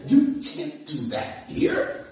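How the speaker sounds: high, slow, drawn-out reproach; mock scolding. Companion telling him how to act on the train, mock scolding. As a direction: stretch it out like an appalled companion.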